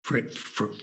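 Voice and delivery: hesitant whisper at the door. Acted. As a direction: whisper the name nervously. Leaning head into room.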